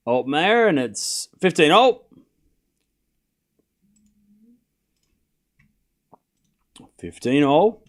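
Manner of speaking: low, quiet tennis commentary; live play-by-play. Acted. Calling the tennis rally for stream viewers, hushed commentator. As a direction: call each shot like a TV commentator.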